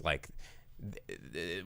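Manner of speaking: ordinary talk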